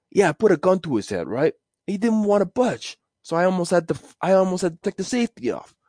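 high, tough New York mobster put-on. Mobster bragging about a hit, wiseguy voice. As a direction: brag like a wiseguy. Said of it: "You think about mobsters".

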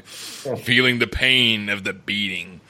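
slow, mocking recital of the line; mock dramatic reading. Shown as a demonstration. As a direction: read it slowly like a cheesy line. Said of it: "That's a bad line".

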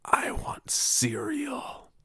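breathy slow whisper, high and hushed; creepy child whisper at the ear; vampire whisper. Retold or imitated. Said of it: "whispering in my ear like a vampire".

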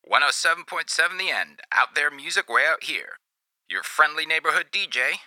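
high, hyped-up radio host; radio DJ blaring through car speakers; shouty radio DJ. Acted. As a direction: announce it like a small-town DJ on air. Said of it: "a voice shouted out over the sound system".

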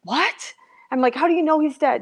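high, stunned retold questions; voicing her own shock on the phone call; shocked disbelief. Shocked disbelief. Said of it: "And I was like".

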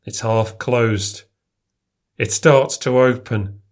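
ordinary delivery, nothing marked

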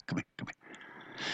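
breathy whisper, slowed down, coaxing. Retold or imitated. Talking to a crowd he can't herd, whispered coaxing. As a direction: whisper them closer like stray kittens. Coaxing stray kittens along.

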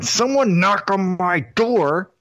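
slow, lightly voiced menacing wrestler; a hint of the wrestler's ominous promo. Host imitating the wrestler's promo for his co-host, slow and ominous.

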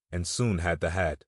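ordinary delivery

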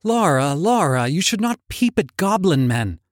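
lightly voiced worried girl; Lizzie warning. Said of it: "cried Lizzie".

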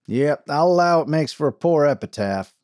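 a wry frontier sheriff, amused. A narrator's reading. Sheriff voiced in story.

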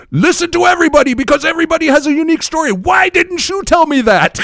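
high-pitched imitated yelling; shouting. Drunk man shaking another guy by the shoulders, high frantic shout. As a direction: yell it like a drunk revelation. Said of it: "he starts shouting it to everyone".

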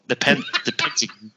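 Pentagon said with a clipped British "Pen-tuh-gun"; mocking own British accent lightly. Shown as a demonstration. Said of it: "You're making fun of my accent".

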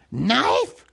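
high, loud creature guess; Gollum's creature voice. A narrator's reading. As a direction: blurt the guess, sliding up high. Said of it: "he said at last".

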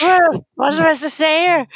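mock-drunk, muddled actor; drunken Orson Welles. Host recalling wine ad outtakes, imitating a drunk actor. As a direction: play a hammered star lost on set. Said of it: "He's totally hammered".

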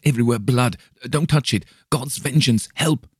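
high, loud panicked cries; terrified. A narrator's reading. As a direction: cry out from a nightmare. Nightmare terror, struggling.

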